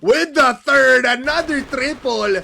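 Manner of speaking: high loud hype call; esports caster. Real, not acted. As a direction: scream the play as it happens. Caster calls a live multikill.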